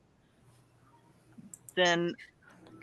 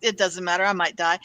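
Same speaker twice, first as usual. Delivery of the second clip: usual speaking voice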